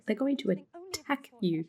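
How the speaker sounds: normal talking voice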